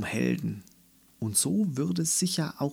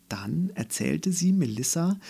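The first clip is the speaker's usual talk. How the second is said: ordinary delivery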